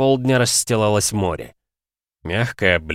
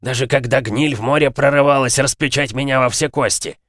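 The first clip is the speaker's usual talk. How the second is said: gruff gravelly dwarf voice; 6 semitones higher, 4 dB louder than usual. Acted. Dwarf keeps his voice.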